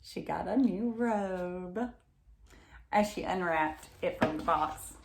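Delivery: ordinary delivery, nothing marked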